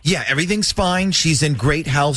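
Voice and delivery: ordinary talk, nothing marked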